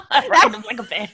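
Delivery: laughing Scottish accent attempt. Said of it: "I can't do a Scottish accent".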